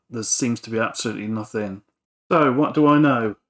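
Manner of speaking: usual speaking voice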